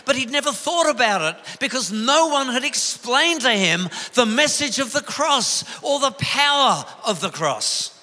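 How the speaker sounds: rally the congregation from the pulpit; impassioned pulpit preaching; preaching at full force. Acted.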